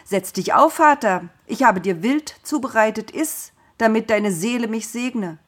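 cheerful. A narrator's reading. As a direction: announce the meal proudly and gladly. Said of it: "sprach fröhlich".

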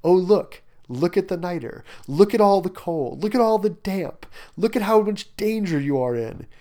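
needling, sing-out warnings; goading. Acted. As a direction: poke at them, pointing out each danger. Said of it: "poking him some more".

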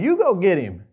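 high, loud, alarmed guards backing away; panicked officers. Retold or imitated. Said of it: "They're basically saying".

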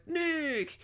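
very high, soft drawn-out squeal; hammed-up swooning call in falsetto; mock lovers' cry. Reenacts her answering call.

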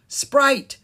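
high, loud call of a name; sudden, loud shout; cried out. Acted. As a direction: call to Sprite across the playground. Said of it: "she cried".

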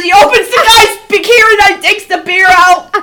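ordinary delivery

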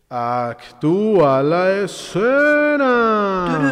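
announcer voice. Shown as a demonstration. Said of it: "announcing segment title".

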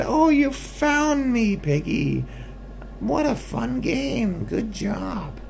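very high, slow squeal of delight; squeaky little skunk cheering the finder. Acted. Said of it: "the skunk said".

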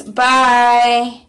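ordinary delivery

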